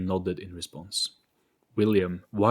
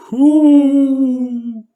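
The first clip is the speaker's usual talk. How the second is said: very high, very loud held wail; ghostly wail; 14 dB louder than usual. Acted. The ghost asks the group again, very high and loud. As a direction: stretch it out, ghostly. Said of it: "It asked again".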